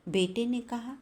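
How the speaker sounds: usual speaking voice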